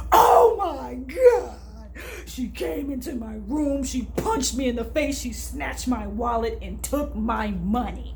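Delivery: hammed-up wailing, slow and loud at first; mock sobbing complaint. Mocking how they describe a made-up attack, with hammed-up sobbing. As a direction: fake a tearful victim story, overplaying the hurt. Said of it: "they have a funny way of describing things like this".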